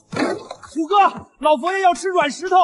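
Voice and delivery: high urgent call across the kitchen. Acted. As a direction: shout the news to Tiger. Calling out to the cook. (高声喊人)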